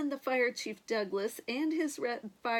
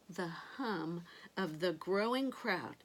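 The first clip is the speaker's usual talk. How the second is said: ordinary delivery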